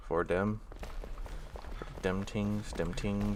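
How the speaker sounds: "dem tings" with d for th in "dem" and t for th in "tings". Pretend. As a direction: say "Four dem" and "dem tings", th turned to d and t. Playful joke while placing items.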